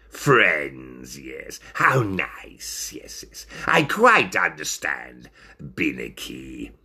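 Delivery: low, partly whispered, creepy agreement; insincere. Acted. Ringmaster pretending to accept the friendship, low and insincere. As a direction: draw it out low and breathy, feigning sympathy. Ringmaster feigns understanding, sinister.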